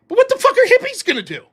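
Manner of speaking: fast, high, loud taunt; taunting shout. Acted. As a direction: jeer it as a rhetorical challenge. Taunting rhetorical question, much raised.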